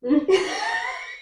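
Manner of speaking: high squeaky laugh; embarrassed giggle. Real, not acted. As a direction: giggle sheepishly. Laughs at own wrong answer.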